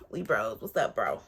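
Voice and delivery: dropped low, buddy-buddy bro greeting. Pretend. Greeting her ex like a buddy, in a low bro voice.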